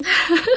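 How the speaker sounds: short burst of laughter; quick amused laugh. Real, not acted. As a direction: laugh at your own bad joke. Laughs at own impression.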